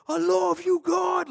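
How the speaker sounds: high, loud cry of love to God; imitating his viral praise shout. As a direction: cry it out with full joy. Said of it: "that's where he got the".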